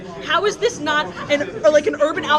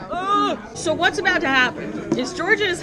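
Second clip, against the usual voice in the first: ordinary talk, nothing marked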